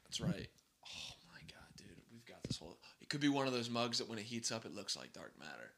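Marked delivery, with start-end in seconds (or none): 0.8-3.1 whispered, voiceless exclamation; hushed awe. Real, not acted. Struck by a coffee mug idea, hushed whisper.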